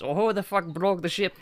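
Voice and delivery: high, loud mock-Irish complaint; Felix's Irish outburst. Imitating a friend mad over a broken ship, loud Irish accent. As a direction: raise the voice into an exasperated Irish accent. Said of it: "he'll raise his voice".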